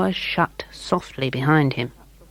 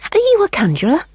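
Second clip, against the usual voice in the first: put-on higher, eager boy asking politely; a small boy's polite voice; 13 semitones higher, 4 dB louder, much faster than usual. A narrator's reading. William voiced.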